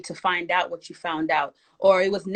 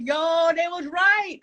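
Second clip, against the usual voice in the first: high, slow shouted "told you so"; triumphant shout; 8 semitones higher, slower than usual. Pretend. Picturing the listener after the storm, shouting in slow triumph. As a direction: crow it out like you finally got proof. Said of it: "you're gonna be like".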